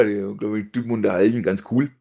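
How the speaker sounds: usual speaking voice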